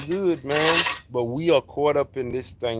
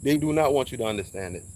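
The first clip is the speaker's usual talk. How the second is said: ordinary talk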